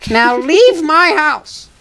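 slow, high, hammed-up command; mock eviction order. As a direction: draw it out high as you throw them out. Acted eviction in a bit.

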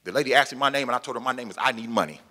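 ordinary delivery

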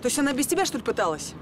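high furious shout; angry shouting. Acted. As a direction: blast him with outrage. Lover's quarrel, furious reply.

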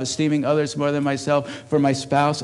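ordinary talk

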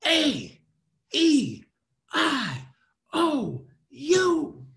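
high shouted vowels, each on its own; emphatic high bursts, falling sharply; loud, slow emphasis. Shown as a demonstration. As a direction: belt each letter with a pause after. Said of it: "Now emphasis".

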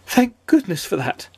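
a touch of high-voiced relief; relieved. A narrator's reading. Mister Jelly after his fright passes, relieved.

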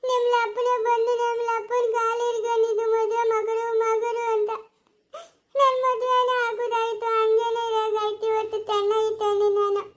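slow sing-song wailing; keen through tears in long, drawn-out phrases; crying lament. Acted. Woman lamenting her wedding to Tarunya, wailing in tears. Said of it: "ತಿರ್ಗಾ ನೋಡು ಅಳ್ತಾ ಇರೋದು".

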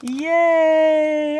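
loud high happy cheer; excited cheer. Real, not acted. Cheers at cookies.

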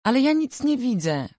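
put-on high, whiny little-girl protest; a small girl complaining she can't see; indignant protest. A narrator's reading. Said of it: "protestowała oburzona".

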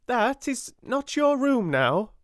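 awkward woman barring Sarah's door; nervous Miss Amelia. A narrator's reading.

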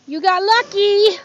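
loud, slow, happy cheer to the dog; delighted cheer. Real, not acted. Rescuer cheering the shelter dog, loud and slow. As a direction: cheer the dog's good fortune.

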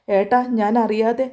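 ordinary delivery, nothing marked

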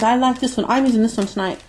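ordinary talk, nothing marked

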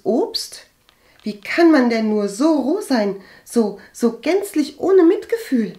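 loud, high tirade, climbing to the end; furious reproach. A narrator's reading. Agathe condemns cousin's family for heartlessness, furious. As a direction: berate her in mounting outrage. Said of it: "stieß sie wütend hervor".

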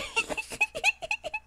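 shrill cackle, very high; high shrieking laugh. Real, not acted. Streamer cackling that the villager is still angry, shrill laugh.

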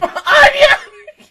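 loud high squeal of laughter; delighted scream-laugh; shrieking laugh. Real, not acted.

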